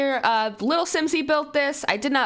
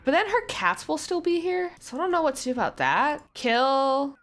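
usual speaking voice

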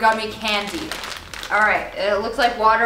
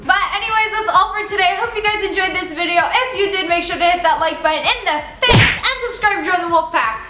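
higher, peppy sign-off voice; upbeat vlog outro; 8 semitones higher, 3 dB louder than usual. Acted. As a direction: sell the subscribe ask cheerfully.

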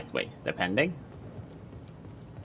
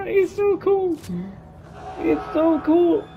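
stretching groan; 4 dB louder, slower than usual. Real, not acted. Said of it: "sorry, I'm stretching".

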